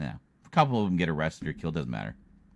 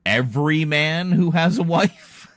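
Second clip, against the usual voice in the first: incredulous; 9 semitones higher, 8 dB louder than usual. Real, not acted. Incredulous retort.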